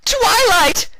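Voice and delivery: final high piercing scream; condemned prisoner screaming at Twilight. Acted. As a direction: scream her name in terror. Last scream before execution.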